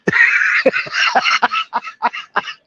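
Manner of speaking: high laugh. Real, not acted. Laughing at his own retelling.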